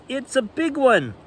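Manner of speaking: high, thrilled about the big fish; Papa Bear sure he has a big fish; excited. Acted. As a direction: brag about a big one. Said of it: "called Papa".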